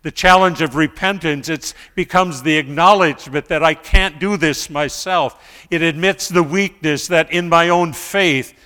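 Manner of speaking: loud, fervent sermon delivery; preaching at full force. Acted. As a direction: drive the point home to the congregation.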